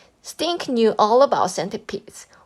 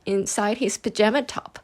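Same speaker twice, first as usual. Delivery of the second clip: plain reading voice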